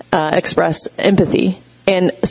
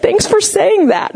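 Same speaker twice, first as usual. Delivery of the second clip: high-pitched imitation of teary thanks; mock teary. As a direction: play it touched and weepy. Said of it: "she got all teary".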